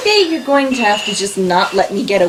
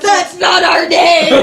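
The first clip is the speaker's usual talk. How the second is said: laughing shout; 4 semitones higher, slower than usual. Real, not acted.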